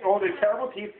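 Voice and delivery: ordinary delivery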